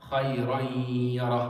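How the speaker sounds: "Khayran yara" chanted slowly with a long nasal y; ghunna on the ya. Shown as a demonstration. Teacher demonstrating tanween to students, long nasal ya in "Khayran yara". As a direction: merge the n of "Khayran yara" into a held, level ya. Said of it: "On the ya".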